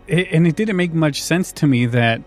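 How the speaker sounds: ordinary talk, nothing marked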